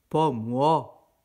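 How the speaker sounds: refus grogné, plus fort; cochon grognon. A narrator's reading. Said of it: "grogna le cochon". (le cochon refuse encore, grognement)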